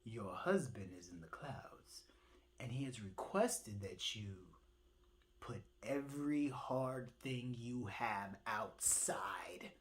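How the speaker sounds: low, hushed whisper; soft low bird whisper; whispered message. Acted. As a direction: pass on a secret message.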